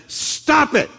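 high sharp command; Jesus rebuking the wind, sharp and high. Acted. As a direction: order the storm to stop.

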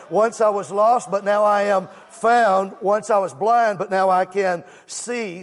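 loud, slow, ringing proclamation; full-force preaching. Acted. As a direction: belt the testimony to the congregation. Preaching hymn words at full force.

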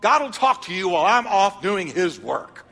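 hammed-up indignant certainty; self-righteous. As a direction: declare it as if God is on your side. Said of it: "My attitude was".

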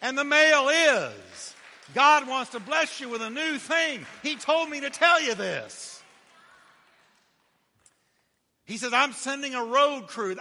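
loud, high preacher cry; full-force preaching. Acted. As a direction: shout the good news to the crowd.

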